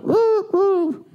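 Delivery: high squeaky bird call; canary voice. Shown as a demonstration. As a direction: chirp it like a cartoon bird. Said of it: "That was my canary voice".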